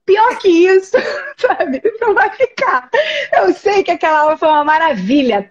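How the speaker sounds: real laughter breaking into the words; laughing while talking. Real, not acted. A teacher laughing at her own classroom gaffe, words shaken by laughter. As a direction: laugh through the story's punchline.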